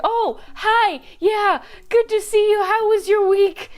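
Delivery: put-on a girl faking delight to greet a friend; fake cheerful. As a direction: gush hello to escape the creep.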